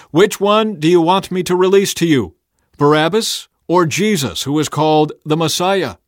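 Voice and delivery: loud, high address to a crowd. A narrator's reading. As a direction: put the choice loudly to everyone.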